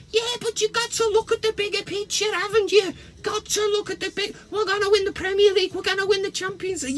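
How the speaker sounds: high-pitched mock fan voice, fast at the end; imitating a smug Scouse fan; mocking Liverpool fan. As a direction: parody a deluded fan. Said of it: "it's like".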